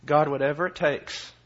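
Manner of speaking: ordinary talk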